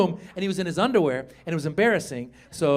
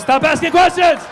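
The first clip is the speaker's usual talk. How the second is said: high, loud burst; exasperated shout; 10 semitones higher, 8 dB louder than usual. Retold or imitated. Cornered father to his daughter, exasperated high shout. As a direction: shut the questions down with a yell.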